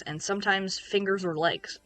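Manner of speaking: normal talking voice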